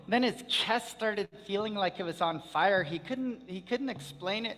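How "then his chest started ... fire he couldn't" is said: plain reading voice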